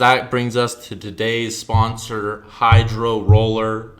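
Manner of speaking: mock ad read. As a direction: announce the product slowly like a radio ad.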